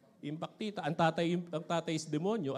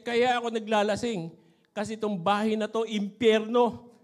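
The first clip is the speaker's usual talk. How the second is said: louder, slow on impyerno; husband snapping back; 3 semitones higher, 8 dB louder than usual. Retold or imitated. Drunk husband answers his wife, loud with impyerno drawn out. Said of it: "sabi niya".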